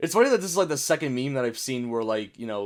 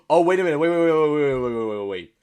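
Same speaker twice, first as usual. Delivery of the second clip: sudden confusion; 6 dB louder than usual. Real, not acted. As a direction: blurt it out loud, then let it tail away. Sudden confusion over a meme.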